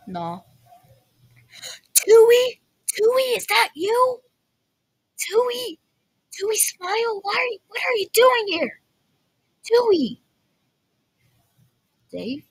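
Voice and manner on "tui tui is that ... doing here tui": much higher, bright calling out; excited calling. Real, not acted. Player spotting Tui, calling to her in high excitement. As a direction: call a long-lost friend with delight. Excitedly greeting a familiar character.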